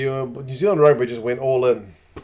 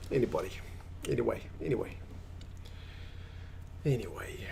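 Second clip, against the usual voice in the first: whispered aside; 12 dB quieter, faster than usual. Real, not acted. Muttered aside to himself.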